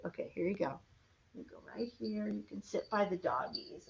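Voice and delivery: soft, quiet voice settling the toys; hushed settling. Acted. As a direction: murmur gently to the toy animals.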